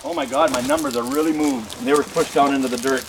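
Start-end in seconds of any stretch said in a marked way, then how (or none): 0.0-1.7 sudden high-pitched "Oh my God" of alarm; startled, voice jumping high; surprised. Real, not acted. Finds markers pushed down.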